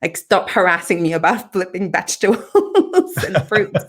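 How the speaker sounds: mock protest breaking into laughter; laughing exasperation. Patient retelling her plea to the therapist, laughing exasperation. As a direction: complain through a laugh.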